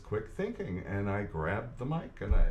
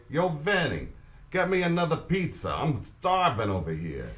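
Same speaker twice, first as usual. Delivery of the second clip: Italian American accent; 6 semitones higher, 3 dB louder than usual. Shown as a demonstration. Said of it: "I want you to say".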